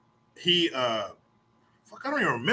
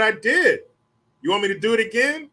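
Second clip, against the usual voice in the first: cocky retort; 8 semitones higher, 4 dB louder than usual. Retold or imitated. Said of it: "I said".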